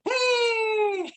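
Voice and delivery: very high, long happy squeal; child's cheer. Retold or imitated. Child dying happy in the crash, gleeful squeal. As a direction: imitate a kid cheering to the end. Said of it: "yang satu mati".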